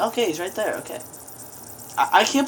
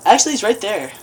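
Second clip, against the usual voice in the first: ordinary talk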